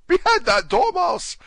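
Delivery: string of piercing, high-pitched orders; loud falsetto royal tantrum; shrieking Queen. A narrator's reading. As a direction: screech each command at the top of the voice. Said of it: "the Queen shrieked out".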